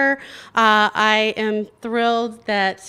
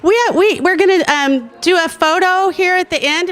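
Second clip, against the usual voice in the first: high, loud, tripping over words; flustered excited call; 8 semitones higher, 5 dB louder than usual. Real, not acted. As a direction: rush it out excitedly. Flustered call for group photo.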